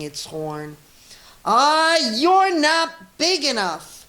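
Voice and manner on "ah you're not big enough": very high, loud, slow scoff; grouchy ladybug sneer. A narrator's reading. Ladybug sneering at the rhinoceros, high and loud. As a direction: scoff down at the big beast. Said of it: "said the grouchy ladybug".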